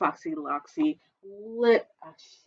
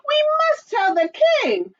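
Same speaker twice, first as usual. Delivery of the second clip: high chick voice; 4 dB louder than usual. Acted. Chicken Little.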